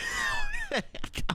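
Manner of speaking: very high wailing laugh; shrieking laugh. Real, not acted. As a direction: let it squeal out. Laugh-crying.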